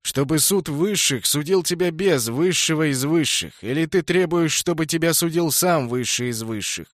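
ringing high herald's call; town crier. A narrator's reading. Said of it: "продолжал секретарь".

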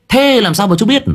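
lightly voiced young listener asking; a child's eager question; young girl voice. A narrator's reading. Young listener asks in story.

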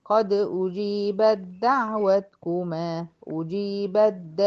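slow أجيبت د, ت swallowed, ب straight into doubled د; slow tajweed demo; recited merge. Shown as a demonstration. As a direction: chant slowly with full merging. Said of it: "من الب على الدال".

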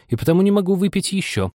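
high-pitched huffy reply; offended. A narrator's reading. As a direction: protest high, a little hurt. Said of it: "обиделась Аня".

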